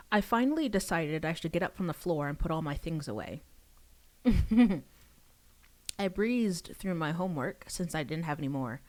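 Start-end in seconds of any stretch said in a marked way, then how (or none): 4.2-4.8 burst of laughter. Real, not acted. Reader laughs at own story.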